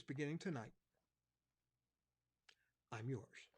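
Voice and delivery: heartfelt prayer of surrender. Acted. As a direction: lead the congregation in prayer. Leads a prayer.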